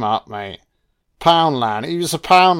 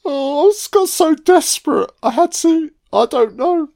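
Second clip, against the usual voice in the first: high, put-on whiny excuse voice; mock character voice. Mocking the film character's lame excuse in a high whiny voice. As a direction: whine it out much higher like a feeble excuse.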